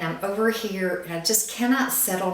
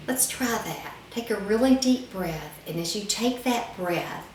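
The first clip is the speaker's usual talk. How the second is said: normal talking voice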